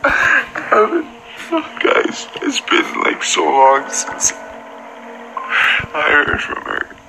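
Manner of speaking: tearful, mostly voiceless and broken up by pauses; close to crying. Real, not acted. Man tells viewers she's been reaching out, tearful. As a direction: struggle to get it out through tears. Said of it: "Don't cry, [name]".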